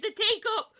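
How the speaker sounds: loud, high mock meltdown; overdramatic outrage. Ranting to co-host about a Pokemon teacup, overdramatic. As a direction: wail it in exaggerated disbelief. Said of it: "I'm just being overdramatic".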